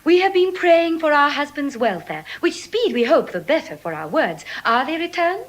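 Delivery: loud, high and eager; loud high calling voice. Acted. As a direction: call across the garden. Portia returns, calling out anxiously.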